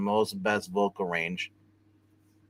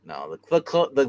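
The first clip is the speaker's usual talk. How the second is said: ordinary talk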